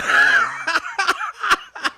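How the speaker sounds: bright real laugh; high burst of laughter. Real, not acted. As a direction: laugh out loud. Laughs at the Yale question.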